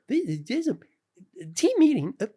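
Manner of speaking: very high, halting cartoon character voice; Jimmy. Calling a team meeting over the PA, in Jimmy voice. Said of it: "Jimmy a lot".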